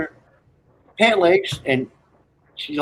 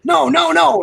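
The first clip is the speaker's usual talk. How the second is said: hammed-up refusal; 13 semitones higher, 5 dB louder than usual. Private refuses in joke.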